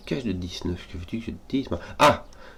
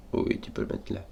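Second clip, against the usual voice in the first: fading to a whisper; 7 dB quieter than usual. Shown as a demonstration. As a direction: breathe it out almost silently. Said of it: "Non, tu peux pas le mettre là".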